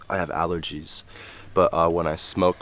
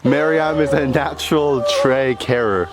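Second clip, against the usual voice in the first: higher, quick wildlife narrator voice; nature documentary; 4 semitones higher, 5 dB louder than usual. Pretend. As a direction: narrate like a hushed safari host.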